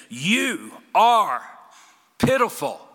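slow, loud accusing voice of the Lord; forceful rebuke. Acted. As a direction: preach it like a finger jabbed in their chest. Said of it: "finger right in their chest, and he says".

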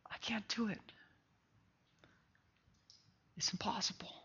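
soft whispered surrender; whispered confession. Acted. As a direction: breathe the words like a prayer.

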